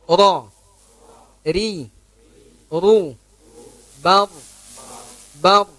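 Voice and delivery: "Ra", "Bar" dengan getaran sedikit saja; light trilled r. Shown as a demonstration. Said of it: "Kan dikit aja bunyinya, getarannya". (getaran "ra" cukup sedikit)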